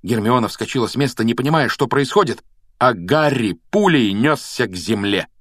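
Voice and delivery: ordinary delivery, nothing marked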